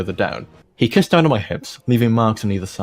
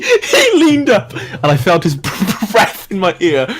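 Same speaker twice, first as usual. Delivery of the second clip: laughing; 9 semitones higher, 5 dB louder, slower than usual. Real, not acted. Laughing while reading.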